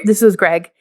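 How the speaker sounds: ordinary talk, nothing marked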